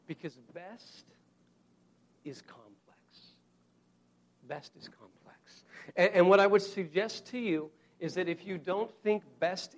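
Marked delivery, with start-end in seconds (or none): 0.1-3.0 dropped to a hushed whisper. Shown as a demonstration. Preacher to congregation, soft whispered emphasis. 4.5-5.6 slow voiceless whisper. Shown as a demonstration. Preacher leaning in to congregation, slow whisper. Whispered repeat of key line.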